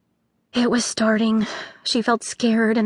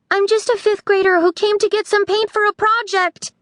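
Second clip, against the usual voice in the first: Pepper yelling; 11 semitones higher than usual. A narrator's reading. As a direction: yell over the chaos. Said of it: "she yelled at the chaos".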